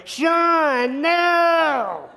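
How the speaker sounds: high, loud desperate yell from afar; retold yell. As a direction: yell the NO at him through the window.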